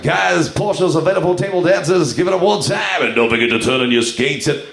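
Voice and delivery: strip club DJ patter; confused DJ on double shift. Acted. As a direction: rattle off a sing-song club announcement. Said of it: "I'm like".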